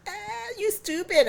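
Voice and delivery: very high mocking jeer; teasing brother. Wes mocking his sister, high scornful jeer. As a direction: sneer like a smug big brother. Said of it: "Wes is like".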